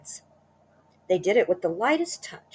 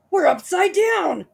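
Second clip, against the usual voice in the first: high, loud, slow shocked cry; shocked gasp; 13 semitones higher, 9 dB louder than usual. Acted. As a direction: cry out in disbelief. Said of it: "gasped Mister Twit".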